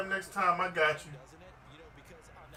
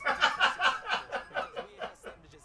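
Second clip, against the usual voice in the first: high wheezing laugh; 8 semitones higher than usual. Real, not acted. Big laugh at friend's answer.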